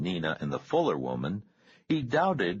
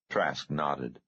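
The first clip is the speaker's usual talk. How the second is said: ordinary delivery, nothing marked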